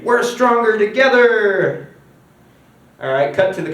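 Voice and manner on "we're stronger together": high, loud, slow proclamation; drawn-out rousing cry; rallying slogan. Acted. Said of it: "he goes on the screen".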